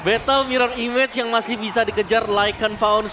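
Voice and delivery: excited. Real, not acted. Shouting play-by-play in teamfight.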